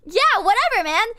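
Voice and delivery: high, loud mock-agreeable brush-off; sarcastic dismissal. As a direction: chirp it out, fake-cheerful, done with him. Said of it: "And I was like".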